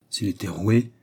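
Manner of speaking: plain reading voice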